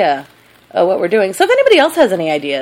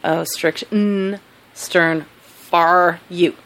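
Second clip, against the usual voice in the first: very slow, drawn-out spelling-out of a name; exaggerated enunciation; 2 semitones lower, much slower than usual. Shown as a demonstration. Clarifying a co-host's Twitter name, slow and exaggerated. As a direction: stretch STERN FOR YOU out word by word. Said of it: "is that your dig at my, uh, southern accent?".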